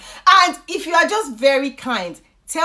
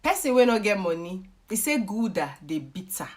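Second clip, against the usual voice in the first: Nigerian Pidgin proverb; 4 semitones lower, 7 dB quieter than usual. Shown as a demonstration. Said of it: "there's a proverb in Nigeria that says".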